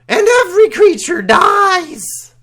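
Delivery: very high, loud goblin shriek; guttural goblin scream. Acted. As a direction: scream it like a goblin leader to the crowd. Goblin leader continues.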